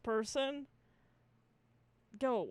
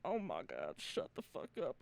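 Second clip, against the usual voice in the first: muttered low and much softer, under the breath; annoyed mutter; 11 semitones lower, 8 dB quieter than usual. Retold or imitated. Annoyed at the witch laugh during the show, low quiet mutter. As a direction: grumble it quietly to yourself. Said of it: "And I was like".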